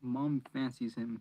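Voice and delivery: ordinary delivery, nothing marked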